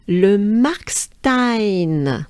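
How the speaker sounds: Le Markstein very slowly, with a French R; French name. Shown as a demonstration. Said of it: "this name starts with le".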